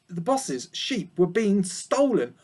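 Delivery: ordinary delivery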